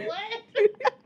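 high laughing disbelief; real helpless laughing what. Real, not acted. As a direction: squeal it mid-laugh. Cracking up at the text.

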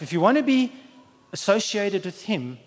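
normal talking voice

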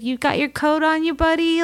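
lightly voiced motherly fussing, pitched high; doting mother. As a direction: fuss over him as if he were a little boy. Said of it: "I just feel a maternal instinct".

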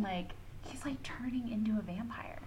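hushed whisper for the big twist; whispered reveal. Real, not acted. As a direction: drop to a conspiratorial whisper, like telling a secret. Secret twist revealed to friend.

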